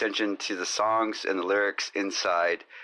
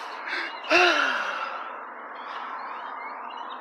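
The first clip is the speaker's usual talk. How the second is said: exaggerated sigh; 3 dB quieter than usual. Exaggerated loud breath out.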